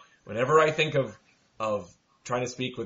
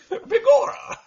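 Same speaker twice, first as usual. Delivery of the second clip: mock Irish; 7 dB louder, slower than usual. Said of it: "if we have to have an accent, it has to be Irish".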